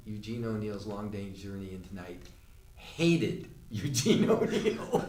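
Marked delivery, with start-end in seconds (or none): 2.8-5.0 slow, high-pitched delivery cracking up; laughing punchline. Real, not acted. Storyteller delivering the review's verdict, cracking up laughing. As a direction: draw out the punchline and burst out laughing.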